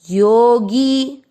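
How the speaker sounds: Yogi with the गी stretched long and slow; drawn-out yogi. Shown as a demonstration. Teacher stretching Yogi so the class hears the long vowel. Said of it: "लंबा खींचिए".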